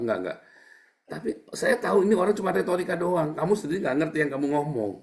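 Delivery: loud, high preaching rant; fiery preaching. Acted. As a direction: thunder it at the congregation. Preacher rails against rhetoric. (khotbah lantang dan berapi-api)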